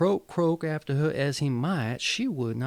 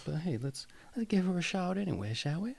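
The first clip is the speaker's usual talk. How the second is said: ordinary delivery, nothing marked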